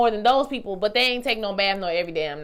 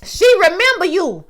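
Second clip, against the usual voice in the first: excited realization; 8 semitones higher, 9 dB louder than usual. Retold or imitated. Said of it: "I said".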